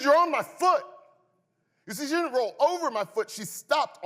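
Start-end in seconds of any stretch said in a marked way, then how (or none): 0.0-0.8 much higher and louder, strained and pleading; desperate high yell. Retold or imitated. Boy yells up through the car window that she's on his foot, strained. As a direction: squeal it, begging her to notice.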